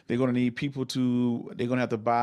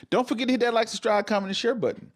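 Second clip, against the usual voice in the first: excited; 10 semitones higher than usual. Acted. Excited call to subscribe.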